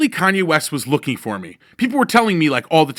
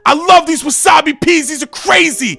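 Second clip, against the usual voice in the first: much higher, much louder, half sung; 13 semitones higher, 9 dB louder than usual. Retold or imitated. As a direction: rave about snacks to the whole table. [name] raving over peas.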